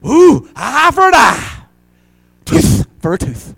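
high, loud, slow mocking sing-song; mocking vengeful quote. Pastor mocks churchgoers quoting eye for eye, high and loud.

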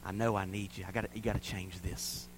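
very soft, low plea; hushed prayer. Retold or imitated. Daughter opening up to the Lord, imitated hushed prayer. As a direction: pray it almost under the breath. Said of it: "she opened up and said".

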